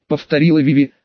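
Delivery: ordinary delivery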